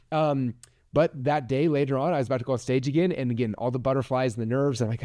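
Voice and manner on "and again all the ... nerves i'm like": ordinary talk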